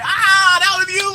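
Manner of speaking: high, loud surprised ah; light up as it clicks who it was; surprised realization. Retold or imitated. Realizing the fan on the live was the driver, surprised. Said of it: "And I was like".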